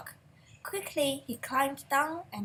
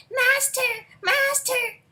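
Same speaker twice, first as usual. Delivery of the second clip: much higher, louder alarmed cry; harp crying out; 12 semitones higher, 7 dB louder, slower than usual. A narrator's reading. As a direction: cry out for help in a high voice. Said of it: "the harp cried".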